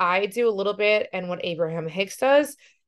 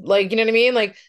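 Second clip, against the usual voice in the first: usual speaking voice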